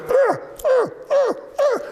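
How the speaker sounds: repeated high, loud yelps; high-pitched struggling cries. Acted. Winter with sap on his face, struggling yelps. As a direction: cry out as if stuck fast.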